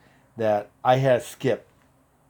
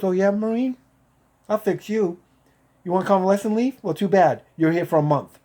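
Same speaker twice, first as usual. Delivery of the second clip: retold taunting threat, higher pitch; threatening officer; 8 semitones higher than usual. Officer getting back at the Marine over his leave, taunting. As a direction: play the spiteful officer, pitched up. Officer retaliates against angry patient.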